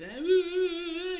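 put-on loud, high-pitched child's wail; hammed-up squealing sobs; mock bawling. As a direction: imitate a little girl crying her head off. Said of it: "la petite fille qui braille sans bon sens".

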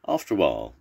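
usual speaking voice

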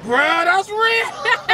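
high slow cry-laugh; laughing hard. Real, not acted. Host cracks up at the jailhouse burrito idea, laughing. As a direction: crack up so hard it almost sobs.

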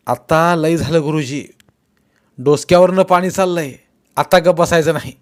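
we have had enough, say it hot; angry outburst. Acted.

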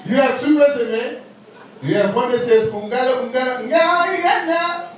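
put-on high, rising African-accented delivery. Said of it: "I like using that accent".

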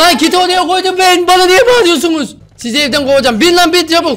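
loud, high-pitched telling-off; angry scolding shout. Real, not acted. Gamer scolding noisy teammates over a kit, angry shouting. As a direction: yell at the squad and rush them along. Scolds squad clamouring for kits.

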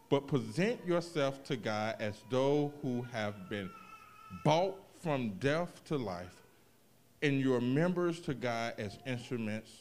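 deliberate sermon-style scripture read. Acted. Reads scripture verse aloud.

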